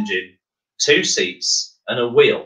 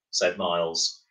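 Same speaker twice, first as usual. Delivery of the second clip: plain reading voice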